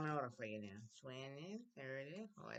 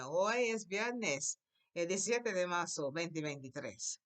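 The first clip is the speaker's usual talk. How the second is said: slow start on "Hoy", then normal pace; slow model again. Shown as a demonstration. Teacher models the date again for the class. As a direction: repeat the sentence slowly. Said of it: "Try again".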